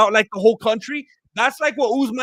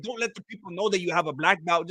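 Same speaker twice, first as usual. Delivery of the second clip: ordinary delivery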